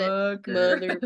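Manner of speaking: slow imitation of a country twang; country accent. Echoing the pop star's lyric, country accent. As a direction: stretch it out, country style. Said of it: "It sounds so country when she says it".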